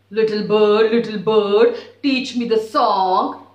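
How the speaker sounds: loud sing-song call to the bird. Acted. Husky calls again.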